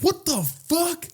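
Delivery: slow, high-pitched disbelief; retold disbelief. Man watching his groper jog away, stunned disbelief.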